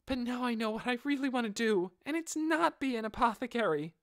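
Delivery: put-on high, boyish voice, earnestly pleading; high young voice begging a hero. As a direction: play a determined boy begging to join the team. Boy explains his wish.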